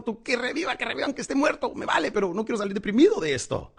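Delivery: high-pitched, acted plea for the hero; pleading moviegoer. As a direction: beg as a viewer who won't leave depressed. Acting a moviegoer wanting the hero revived.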